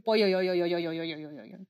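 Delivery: rolling yo yo, dropping and fading; throaty yo sound effect. Shown as a demonstration. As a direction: roll it in the throat. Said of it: "I just love saying".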